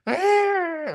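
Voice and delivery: short high piercing mock shriek of a tantrum; a sudden high, loud tantrum yell; imitated toddler scream.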